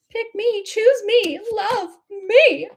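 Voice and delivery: high, sing-out plea to be chosen; mock pleading. Job candidate hoping to be chosen, mock pleading.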